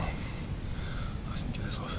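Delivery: breathy exhausted whisper; gasping it out, spent; out of breath. Real, not acted. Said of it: "J'ai pas de cardio". (essoufflé, à bout de souffle)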